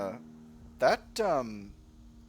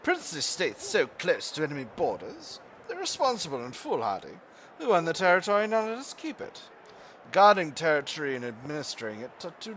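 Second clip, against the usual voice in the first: hammed-up pompous British officer reading the event; a bad British accent, lightly put on; terrible British accent. Shown as a demonstration. Gamer reading a colonial event in a terrible British accent. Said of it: "in my terrible British accent".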